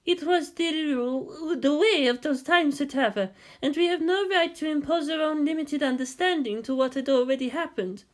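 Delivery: high, calm wise woman's voice; lightly voiced wise woman. A narrator's reading. Hasya correcting Shinji, high and calm. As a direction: gently correct him from above.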